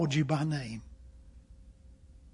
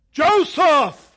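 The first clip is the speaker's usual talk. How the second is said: loud booming call from above; booming voice from heaven; 9 semitones higher, 11 dB louder than usual. Pretend. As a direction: boom the name like God from the sky. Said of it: "an audible, booming voice out of heaven".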